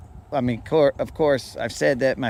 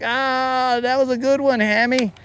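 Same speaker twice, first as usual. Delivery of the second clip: high forced cheer; 10 semitones higher than usual. Pretend. Laughing along with the hamster.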